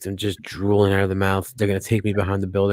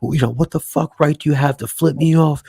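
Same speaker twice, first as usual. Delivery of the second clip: higher-pitched imitation of an irate man; mock a man bugging out at someone; raised-voice rant, acted out; retold angry yelling; 7 semitones higher, 4 dB louder than usual. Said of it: "He's screaming at her".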